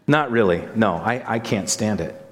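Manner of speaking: normal talking voice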